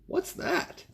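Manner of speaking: tiny raccoon voice asking in wonder; little Chester asking. Acted. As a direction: squeak it out like a small curious kit. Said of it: "asked Chester".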